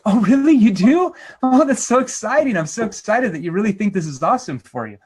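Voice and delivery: high, loud mock gushing; hammed-up excitement, easing toward the end; eager, needy salesperson. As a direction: gush over every word, far too eager. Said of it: "Eager salespeople, needy salespeople would never be skeptical".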